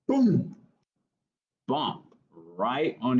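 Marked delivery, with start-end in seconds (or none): 0.1-0.6 boom, popped out high; a sudden bright boom; high loud boom. Acted. Reading a picture book, the landing boom.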